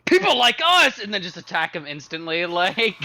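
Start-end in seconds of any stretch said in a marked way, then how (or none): 0.0-1.0 outraged shout. Acted. Player mock-outraged at NPC insult.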